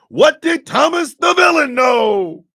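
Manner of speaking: high, slow, loud mocking shout of the question; shouted mockery. Throwing the public's question back at them, shouted. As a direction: bellow it out, slow and scornful.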